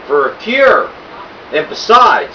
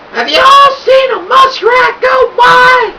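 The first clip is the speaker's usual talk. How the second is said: put-on sweet old lady calling; 4 dB louder than usual. A narrator's reading. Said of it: "a sweet old voice called up to us".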